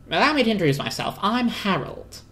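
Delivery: high, quick, pompous little squirrel; Harold the squirrel. Acted. Harold introduces himself to the appliances, quick and pompous. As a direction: rattle off the introduction grandly.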